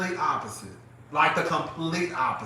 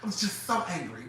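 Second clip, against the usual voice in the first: softer, restrained mock anger; 8 dB quieter than usual. Friend answering the yelling, mimicked restrained anger. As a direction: mimic her mild, contained complaint.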